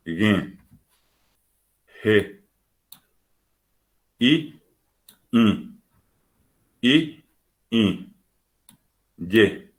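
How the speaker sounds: isolated letter names with long pauses; reading letters in pairs. Shown as a demonstration. Teacher reading the Guaraní alphabet to learners, spaced letter-by-letter drill. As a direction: recite each letter separately, oral vowel then nasal twin. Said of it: "Voy a leerles en pareja".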